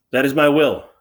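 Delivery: loud, high kingly insistence; forceful. Acted. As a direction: insist like a king. King answering the chorus.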